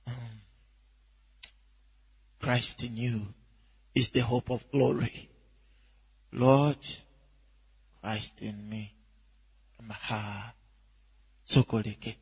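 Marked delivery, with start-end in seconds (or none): 2.4-12.2 low, soft murmured recitation with long pauses; quiet meditation. Shown as a demonstration. Preacher shows a believer starting to meditate, quiet meditation. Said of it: "You're just beginning like this".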